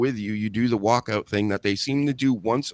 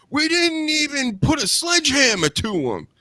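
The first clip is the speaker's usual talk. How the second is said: Kofi Kingston; 4 dB louder than usual. Retold or imitated. Host mimics wrestler protesting to booing crowd, high whine. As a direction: wail it in an indignant falsetto. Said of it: "he comes out, he's like".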